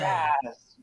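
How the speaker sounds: playful fake gasp; mock shock. Host joins her friend's joke, mock shocked gasp. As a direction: echo a hammed-up shock, amused.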